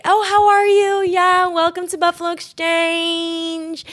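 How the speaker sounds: high, drawn-out sugary shop welcome; exaggerated over-friendly clerk, stretching the words out; gushing shop greeter. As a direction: sing out a mock welcome to shoppers. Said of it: "when you go there to buy stuff, it's great".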